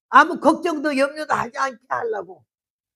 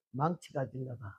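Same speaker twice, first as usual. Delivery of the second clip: muttered reply; 10 semitones lower, 15 dB quieter than usual. Retold or imitated. Husband's low reply.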